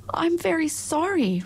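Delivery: put-on high, apologetic girl's voice; Dorothy apologizing. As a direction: say sorry gently, lightly voiced.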